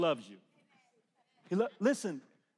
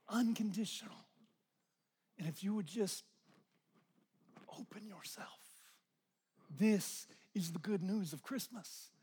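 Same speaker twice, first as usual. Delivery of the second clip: much softer, near whisper; hushed tender preaching; 4 semitones lower, 9 dB quieter than usual. Real, not acted. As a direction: lean in and speak very softly. Intimate hushed ministry.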